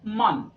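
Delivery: मन with an open 'a' sound instead of O; open vowel sound. Shown as a demonstration. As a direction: draw out मन with an 'uh' vowel. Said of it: "वो ए की sound देगा".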